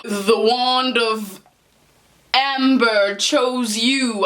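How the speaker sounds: very slow, portentous announcement; hammed-up mystical shopkeeper; awed wand keeper. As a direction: draw out each word like a grand revelation.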